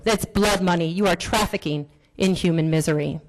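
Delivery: ordinary talk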